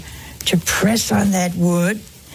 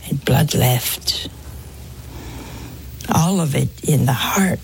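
whispered; 6 semitones lower, 4 dB quieter than usual. Acted. Preacher to congregation, low whisper. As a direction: breathe it out like a secret.

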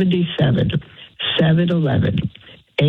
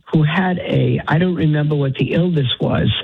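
usual speaking voice

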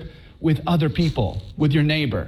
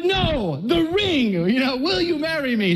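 higher, pleading redirect to the ring; urgent correction; 7 semitones higher than usual. Retold or imitated. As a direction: steer her back to the ring. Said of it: "I'm like".

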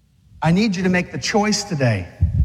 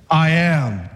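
swaggering Jesus; 4 semitones lower than usual. Acted. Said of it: "He had the man swagger too".

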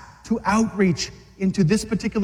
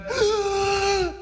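sharp high gasp for breath; deep gasp; 12 semitones higher than usual. Acted. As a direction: gasp before the last words. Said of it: "took a deep breath".

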